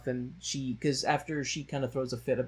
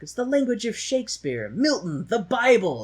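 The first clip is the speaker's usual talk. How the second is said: pompous professor; 10 semitones higher, 6 dB louder than usual. Retold or imitated. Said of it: "he has a thing he's talking".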